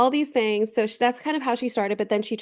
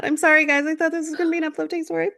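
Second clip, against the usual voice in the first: apologetic; 7 semitones higher, 4 dB louder, faster than usual. Real, not acted. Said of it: "That is so sad".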